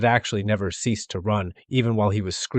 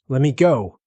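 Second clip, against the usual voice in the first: raised, pleading cry; cried out; 5 semitones higher, 7 dB louder than usual. A narrator's reading. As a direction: cry out to be let go. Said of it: "he cried".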